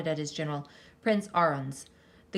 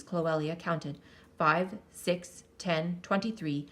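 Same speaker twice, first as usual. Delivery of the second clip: usual speaking voice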